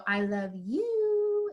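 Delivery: high, long drawn-out last word; escalating sweet declaration. Retold or imitated. Daughter telling her dad, sing-song and drawn out. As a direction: imitate a child stretching "you" up high. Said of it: "And then she'd go".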